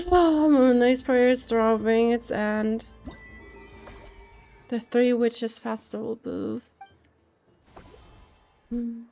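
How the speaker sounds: ordinary talk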